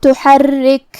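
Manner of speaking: تحرک with a doubled ra and breathy kaf; تحرک, ra held double, kaf released with air. Shown as a demonstration. Teacher shows doubled ra in تحرک. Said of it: "ہم یہ کلمہ کو ایسے پڑھیں گے".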